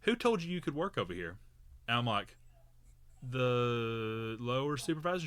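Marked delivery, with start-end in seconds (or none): 0.0-1.4 high-pitched mock questioning; imitated older woman. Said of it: "And she goes". 3.3-5.2 slow, drawn-out, unsure reply; hesitant retold answer. Retold answer to challenging stranger.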